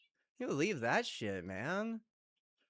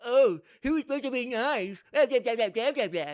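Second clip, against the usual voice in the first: higher, louder sneering put-on voice quoting the haters; mocking whiny critic; 7 semitones higher, 7 dB louder than usual. Mocking the people who'll blame him, higher and louder whiny voice. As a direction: parrot the critics in a mocking high voice. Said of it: "They're gonna be like".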